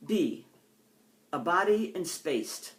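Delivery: slow, measured poetry recital; poem reading. Acted. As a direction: let each image land with a pause.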